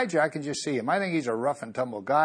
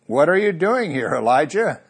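Lord's question; 6 semitones higher, 4 dB louder than usual. Acted. Lord questions hiding prophet.

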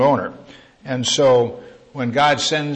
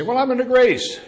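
much higher voice of someone protesting; imagined objector; 12 semitones higher, 4 dB louder than usual. Acted. Congregant answering the preacher with an excuse, in a high protesting voice. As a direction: put on a high-pitched objector's voice. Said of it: "And you say".

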